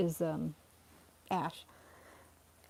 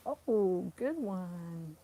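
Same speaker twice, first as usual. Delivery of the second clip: normal talking voice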